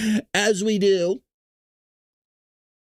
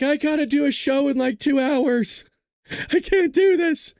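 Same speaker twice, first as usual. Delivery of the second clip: higher, slowing whine of mock despair; exasperated whine; 8 semitones higher than usual. Retelling his late-night panic over the show, a mock whine. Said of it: "And I'm like".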